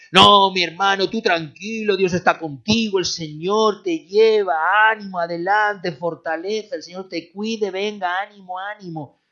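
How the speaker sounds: high, loud, hearty cheering-on; mock the empty pep talk; hollow encouragement. Preacher mimicking shallow comforters cheering someone up, mock-hearty. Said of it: "un tipo de ánimo que no es el espiritual".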